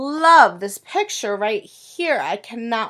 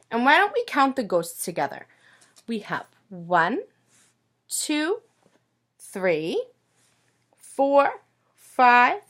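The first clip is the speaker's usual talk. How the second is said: normal talking voice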